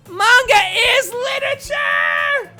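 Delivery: very loud, high, drawn-out shout; indignant outburst. Acted. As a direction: shriek it out slowly in defence. Flustered character's outburst in defence.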